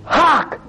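HARK as a sudden, drawn-out exclamation. Acted. Preacher reads out the prophet's cry, HARK exclaimed. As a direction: punch out HARK, then let it fall. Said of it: "we've got an exclamation".

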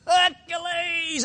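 high, drawn-out showman's cry; arena shout. Acted. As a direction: bellow it out to the arena, stretching the last syllable. Acting Commodus chanting to the arena.

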